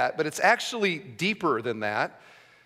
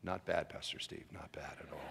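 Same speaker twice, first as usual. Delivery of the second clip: much softer, lower mock grudging praise; mock muttered praise; 13 semitones lower, 18 dB quieter than usual. Congregation member grudgingly praising the sermon, low and soft. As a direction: mumble it under your breath, grudgingly. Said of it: "you might say".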